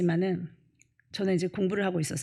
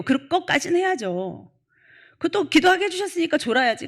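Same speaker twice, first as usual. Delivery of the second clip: louder, higher sermon delivery; full-force preaching; 8 semitones higher, 6 dB louder than usual. Acted. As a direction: preach it hard to the congregation. Preaching to congregation.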